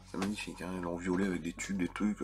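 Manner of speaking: mock approval, muttered with disgust; sarcastic aside. Real, not acted. Sarcastic commentary after the reading. (aparté sarcastique, murmuré bas)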